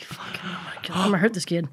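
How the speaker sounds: very soft, under the breath; retold quiet exasperation; exasperated muttering. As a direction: mutter it to yourself, fed up. Retold exasperation at his kid.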